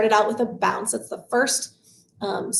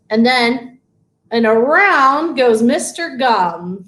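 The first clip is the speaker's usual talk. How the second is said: slow sing-song chant, each finger named; finger-play rhyme; 2 semitones higher, slower than usual. Shown as a demonstration. Teacher chants a finger rhyme for parents and babies, slow sing-song. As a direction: chant it slowly while wiggling each finger.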